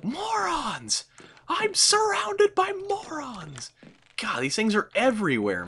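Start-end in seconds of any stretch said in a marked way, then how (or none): 0.0-3.7 much higher, strained exasperated cry, then slow and breathy; shrieking high morons rant; mock ranting villain. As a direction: play an exasperated cartoon villain. Ranting mock character outburst.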